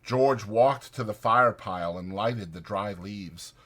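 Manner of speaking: usual speaking voice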